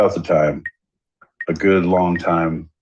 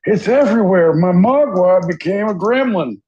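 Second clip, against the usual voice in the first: high silly voice; 13 semitones higher than usual. Acted.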